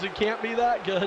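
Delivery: usual speaking voice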